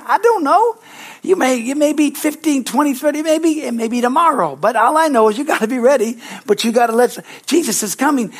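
preaching high and loud, driving each point; full-force preaching. Acted. Preacher pressing the congregation, high and loud. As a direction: build the sermon to a fervent peak.

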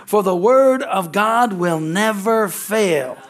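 scripture reading. Acted. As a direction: read the verses out to the congregation. Reads Luke passage aloud.

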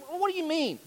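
high-pitched mock objection; imagined puzzled listener. Listener objecting to the preacher's ranking, high and puzzled. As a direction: jump up in pitch as a baffled churchgoer. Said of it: "You're like".

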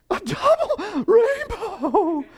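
very high, slow, laughing awe; awed video guy. Retold or imitated. Pastor imitating the weeping rainbow filmer, high laughing awe. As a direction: squeal it out overwhelmed by the rainbow.

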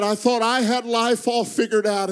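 son's tearful confession at the table. Retold or imitated. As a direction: let the tears rise in the voice. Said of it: "through tears".

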